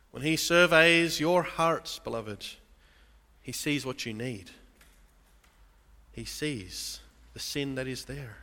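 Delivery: usual speaking voice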